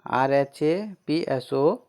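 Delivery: normal talking voice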